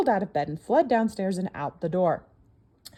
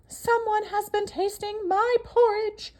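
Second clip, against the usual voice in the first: high, slowish, louder mama bear; middle-sized mama bear voice; 12 semitones higher, 5 dB louder, slower than usual. Acted. Mama bear finds her porridge tasted, high middle-sized voice. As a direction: pitch it up as the mama bear, slow and strong. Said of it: "in her middle-sized voice".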